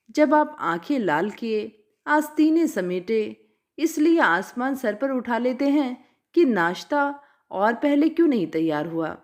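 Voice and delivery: ordinary delivery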